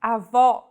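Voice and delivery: Avó with an open stressed o at the end, a-VAW; Avó, grandmother, open ó; open final vowel. Shown as a demonstration. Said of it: "This word means grandmother".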